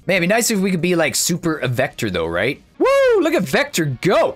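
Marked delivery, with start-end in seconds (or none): 2.8-3.2 high, drawn-out "woo"; excited whoop. Real, not acted. Gamer cheering Vector on, excited high whoop. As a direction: whoop as the character takes off.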